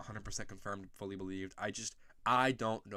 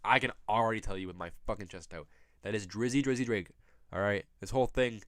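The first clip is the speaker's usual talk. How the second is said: ordinary delivery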